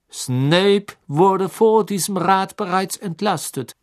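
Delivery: slow, cold official voice; cold judge dismissing the claim; cool and stern. A narrator's reading. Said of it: "sagte Crouch kühl". (kühl und streng)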